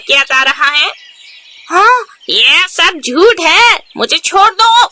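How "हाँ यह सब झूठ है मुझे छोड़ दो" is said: slow, high pleading denial; protesting culprit. Acted. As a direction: beg to be let go while denying it. Jugu protests his arrest.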